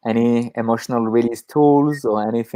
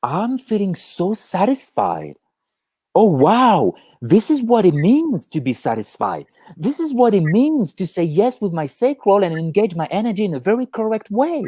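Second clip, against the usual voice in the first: awed excitement; 6 semitones higher than usual. Retold or imitated. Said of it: "aha moment".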